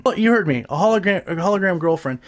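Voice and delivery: usual speaking voice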